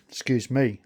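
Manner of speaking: ordinary talk, nothing marked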